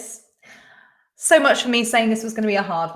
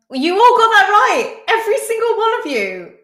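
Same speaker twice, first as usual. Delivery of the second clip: high, loud disbelief; astounded, pitched way up; amazed exclamation; 10 semitones higher, 5 dB louder than usual. Real, not acted. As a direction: exclaim in real astonishment. Amazed everyone answered correctly.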